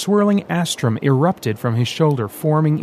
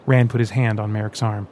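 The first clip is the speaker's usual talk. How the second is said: usual speaking voice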